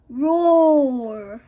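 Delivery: big loud roar like an angry T-Rex; dinosaur roar. A narrator's reading. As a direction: let out a monster roar. T-Rex roars in story.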